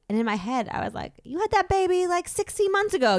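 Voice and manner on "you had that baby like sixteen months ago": high, incredulous inner voice; mock self-scolding. Mother scolds herself inwardly over her excuse, high mock outrage. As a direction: call yourself out, pitched way up.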